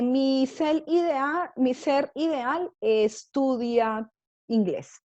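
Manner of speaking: slow, careful dictation of the example. Shown as a demonstration. Teacher to her students, slow, careful dictation. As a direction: spell it out slowly for the class. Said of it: "teacher dictating example".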